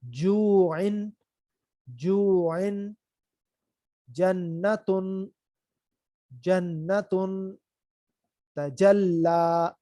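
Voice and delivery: very slow, drawn-out model words; slow, careful Quran-style reading; slow recitation drill. Shown as a demonstration. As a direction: chant each word slowly for repetition. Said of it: "ٹھیک ہے".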